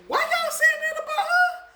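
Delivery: high, loud outraged question; indignant disbelief. Retold or imitated. Calling out relatives who praise the grandma, high and outraged. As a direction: demand it in disbelief. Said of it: "indignant at family praising grandma".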